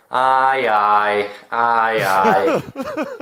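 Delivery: laughing mock agreement, aye drawn out; amused sceptical aye, aye; teasing disbelief. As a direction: chuckle as if you don't buy the story. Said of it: "when someone is telling porkies, we go".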